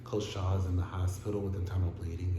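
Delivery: underplay it like Jen; imitating calm Jen. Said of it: "for you just to be like".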